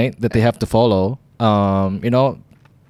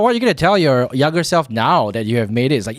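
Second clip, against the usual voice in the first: ordinary delivery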